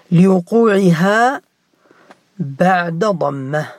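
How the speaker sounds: teacher hammering home the rule; stressing the rule. Shown as a demonstration. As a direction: lean on لوقوعها, slow and emphatic. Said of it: "teacher stressing the rule".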